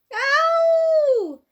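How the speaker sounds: high, loud yelp of pain; a man being knocked about, shouting in pain. A narrator's reading. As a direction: yelp as if struck. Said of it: "shouted the Saucepan Man".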